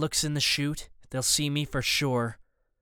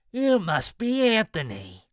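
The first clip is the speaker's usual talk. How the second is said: high, slow, drawn-out menacing call; deep-voiced man down a tunnel; 6 semitones higher, slower than usual. A narrator's reading. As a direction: send it barreling down the tunnel, drawn out. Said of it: "his deep voice barreling down the tunnel".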